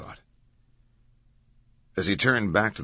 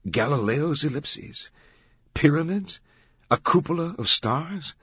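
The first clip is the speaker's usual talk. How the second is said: higher, rising incredulous questions; amazed questioning; 8 semitones higher than usual. A narrator's reading. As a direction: pile up the questions in growing amazement. Langdon's astonished inner questions.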